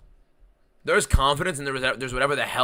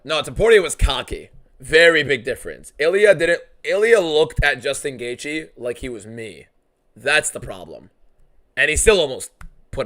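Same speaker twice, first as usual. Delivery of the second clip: loud, heated rant; 4 semitones higher, 12 dB louder than usual. Real, not acted. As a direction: push it out hard and forceful. Heated argument with chat.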